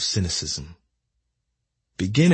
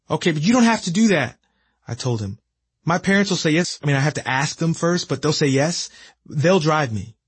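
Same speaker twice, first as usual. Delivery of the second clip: put-on eager teen; 7 semitones higher than usual. Eager teen self on phone.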